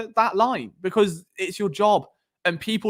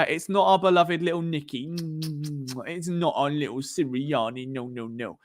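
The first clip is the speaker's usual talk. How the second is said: put-on baby-talk coddling, trailing softer and lower; mock coddling; 3 semitones lower, 4 dB quieter than usual. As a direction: mockingly soothe a beloved little one.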